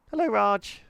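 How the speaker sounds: high, friendly little greeting; smiling hello. Acted. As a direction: say it with a smile.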